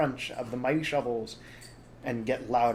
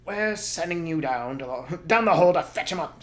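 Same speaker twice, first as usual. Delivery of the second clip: sneering Bean; 6 semitones higher, 6 dB louder than usual. Acted. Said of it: "said Bean".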